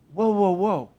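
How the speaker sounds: hushed hold-on; soft calming interjection. Acted. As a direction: ease it down softly, halting the worry. Calming the imagined angry reaction.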